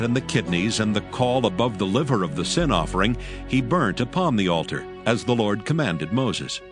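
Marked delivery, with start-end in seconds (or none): none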